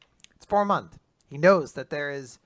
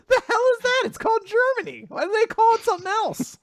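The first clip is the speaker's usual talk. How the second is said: high, loud, mock-outraged; mock outraged squeal; 4 dB louder than usual. Joking about Germany's name in a high indignant squeal. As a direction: squawk in silly indignation.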